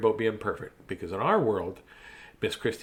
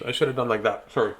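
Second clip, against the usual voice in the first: ordinary delivery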